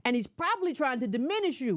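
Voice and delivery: normal talking voice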